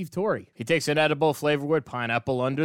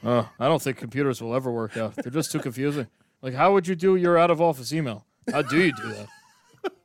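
eighties Steve Torrey; 3 semitones lower than usual. Pretend. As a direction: play a puzzled man from the eighties, lower and understated. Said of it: "Steve Torrey, live from the eighties".

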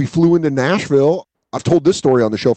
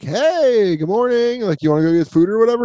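a lightly higher, bright-eyed morning hello; chirpy morning voice; 7 semitones higher than usual. Retold or imitated. Describing the usual morning energy with his girlfriend, lightly chirpy. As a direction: chirp it like a cheerful partner at breakfast.

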